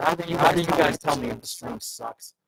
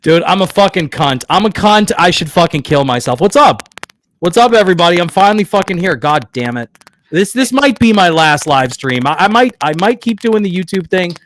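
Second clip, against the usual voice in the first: angry ranting; 2 semitones higher, 9 dB louder than usual. Real, not acted. Still raging after the stream crashed.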